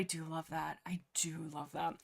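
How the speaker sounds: breathy whispered aside. Real, not acted. Reader whispering her love for the scene. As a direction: whisper it to yourself.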